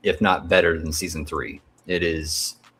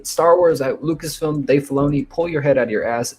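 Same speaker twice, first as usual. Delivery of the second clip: higher-pitched fed-up appeal; exasperated rant; 6 semitones higher than usual. Real, not acted. Fan telling off Lucasfilm's boss, exasperated. Ranting at Lucasfilm and Dave Filoni.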